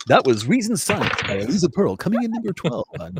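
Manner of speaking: mock radio DJ. Game master back-announcing a player's jingle, mock DJ voice.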